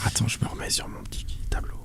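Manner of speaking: breathy whisper to herself. Real, not acted. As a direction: whisper it under your breath. Aside while finding the table.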